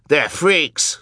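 half-scream it in character; mild scream. A narrator's reading. Hugo insulting the children, high mild scream. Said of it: "screamed Hugo".

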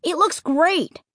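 put-on high, pleading boy's voice. A narrator's reading. Boy talks up the park to parents, pleading. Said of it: "Luke pleaded".

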